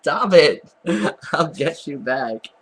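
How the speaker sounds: laughing loudly while tickled; squealing through tickles; being tickled. Real, not acted. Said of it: "tickling me".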